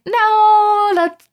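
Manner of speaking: a high, loud sung no; sing-song no. Retold or imitated.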